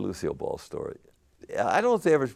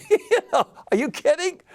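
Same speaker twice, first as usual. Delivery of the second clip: very high, laughing squeal; real laughter through the words; laughing disbelief; 7 dB louder than usual. Real, not acted. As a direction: laugh it out in delight. Laughing aside after eager quote.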